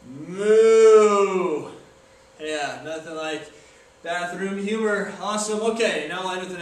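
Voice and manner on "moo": a loud, hammed-up moo; cow moo. Cow impression for the camera, loud moo. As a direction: belt out a big cow moo. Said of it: "The cow looks like this".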